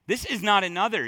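normal talking voice

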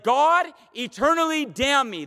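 emphatic preacher acting out a curse; vehement oath. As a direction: swear it to heaven, high and forceful. Acting Peter's oath of self-cursing.

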